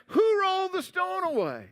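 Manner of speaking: high-pitched, bewildered question; baffled wondering. Acted. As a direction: ask it high, utterly mystified. Women puzzling over the moved stone.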